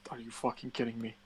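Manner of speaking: usual speaking voice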